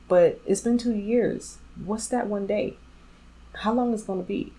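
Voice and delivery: exasperated disbelief. Retold or imitated. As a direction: press him, fed up with waiting. Pressing boyfriend about marriage date.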